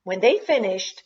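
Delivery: plain reading voice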